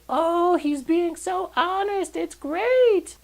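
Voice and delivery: high, airy mock gush of admiring women. Reviewer mocks the women in the movie, high swooning gush. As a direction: squeal it like starstruck cult women. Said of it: "the women are like".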